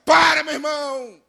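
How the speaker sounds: high, forceful command to stop; loud rebuke. Real, not acted. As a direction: cut them off with a preacher's shout. Cuts off the complainers.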